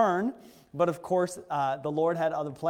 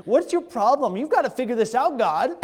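high, loud cry of frustration at God; yelling at God; 7 semitones higher, 8 dB louder than usual. Retold or imitated. Preacher yelling at God over the lockdown, high and loud. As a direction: demand answers, loud and exasperated. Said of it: "I was yelling at God".

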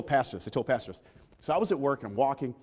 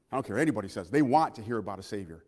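normal talking voice

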